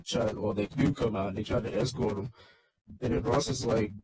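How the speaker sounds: ordinary talk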